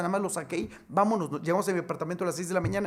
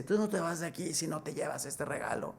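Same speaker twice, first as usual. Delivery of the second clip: soviet director; 8 dB quieter than usual. Acted. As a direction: hand over the gift in a hushed voice. Director insists on gift. (director soviético en voz baja)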